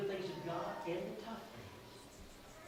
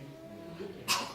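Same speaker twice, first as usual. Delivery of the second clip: slow whispered "You'll get there" to his daughter; tender whisper; 10 semitones lower, much slower than usual. Real, not acted. Father comforting his daughter.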